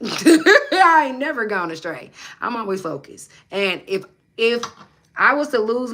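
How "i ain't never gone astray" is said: louder, higher, slow, laughing; laughing rebuttal. Real, not acted. Answering a chat commenter, laughing denial. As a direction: laugh it off, drawn out.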